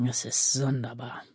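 low, subdued grumbling; hushed, scornful mutter; muttered grumble. A narrator's reading. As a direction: grumble it half to yourself. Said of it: "brummte er".

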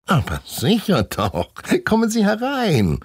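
suddenly sweet, high and loud welcome; honey-sweet. A narrator's reading. Man lures the class inside, sugary welcome. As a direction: pour on the fake friendliness. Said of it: "Seine Stimme klingt jetzt so, wie Honig schmeckt".

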